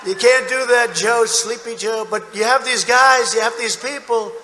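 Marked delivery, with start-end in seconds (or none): none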